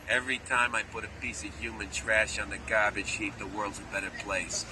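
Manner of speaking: low, softened mock tough-guy delivery; hammed-up movie line. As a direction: play the absurd action-hero line low and straight. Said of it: "I don't do it that way in the movie".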